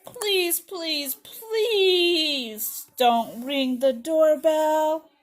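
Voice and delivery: drawn-out begging, acted for children; pleading story character. Scared child begs a friend not to ring the doorbell, pleading. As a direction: beg with long, stretched words.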